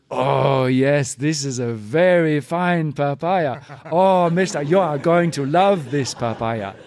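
slow, sing-song market seller; coaxing the buyer, drawn out; brassy gum smacking floozy. Retold or imitated. Market mama selling papaya.

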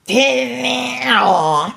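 hammed-up crying call to Tilly; mock wail. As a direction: fake sob to get the biscuit. Wailing for chocolate biscuit.

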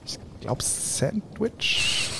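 breathy conspiratorial whisper. Acted. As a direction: whisper it across the cell. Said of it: "dann flüster ich zu dir rüber". (Flüstern zum Zellennachbarn)

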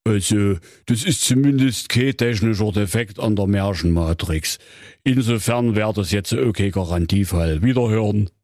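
Grimm brother as a call-centre recording; a polite hotline greeting from Jakob Grimm. Acted. (Jakob Grimm als Hotline-Ansage)

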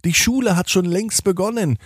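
high-pitched worried mother, then settling; aufgeregte Mama. Acted. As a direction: sound a little flustered about being late. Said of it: "sagt Mama". (aufgeregte Mama, hoch ansetzend)

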